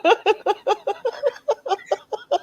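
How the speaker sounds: long, high-pitched laugh. Real, not acted. Teasing a friend mid-story, high helpless laughter. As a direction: let out a sustained high laugh.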